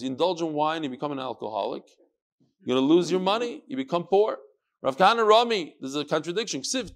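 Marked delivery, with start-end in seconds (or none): none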